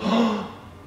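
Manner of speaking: high excited gasp. Pretend. Cheering the dog on for children, gasping. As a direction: gasp with delight.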